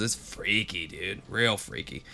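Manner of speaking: hushed and spooked about a scary movie; spooky hush. Acted. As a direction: drop to a creepy hush. Spooky reaction to eerie film.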